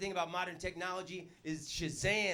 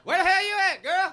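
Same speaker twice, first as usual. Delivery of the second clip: Southern holler; 10 semitones higher, 10 dB louder than usual. Retold or imitated. Comic playing Alabama Romeo shouting for Juliet, high and loud. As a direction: yell it across the yard.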